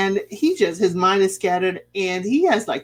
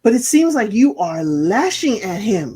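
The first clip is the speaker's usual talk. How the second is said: ordinary delivery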